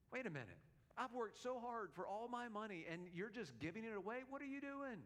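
mock angry. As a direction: act out exasperated disbelief, pitch jumping up. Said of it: "and he's angry".

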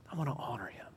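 soft, reverent whisper; very quiet, heartfelt near-whisper; hushed resolve. Acted. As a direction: whisper it like a private vow. Hushed inner resolve about God.

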